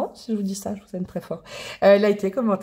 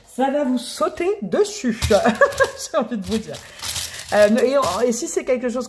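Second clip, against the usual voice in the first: slow, laughing through the words; amused, laughing slowly as she teases viewers; 5 semitones higher than usual. Real, not acted. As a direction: laugh it out, delighted. Laughing while predicting love.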